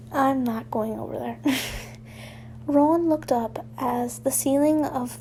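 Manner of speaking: usual speaking voice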